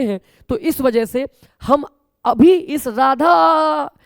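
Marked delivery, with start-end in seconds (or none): 2.2-3.9 Radha repeated slowly and louder, final आ pulled long; stretched vowel. Shown as a demonstration. Teacher repeats Radha to show the stretched आ. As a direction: drag out the आ in Radha again. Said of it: "जो आ लंबा खींचा है".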